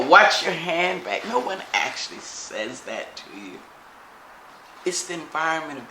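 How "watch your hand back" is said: slow, louder mock warning. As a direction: bark it out like a stranger's warning. Mocking a hostile warning.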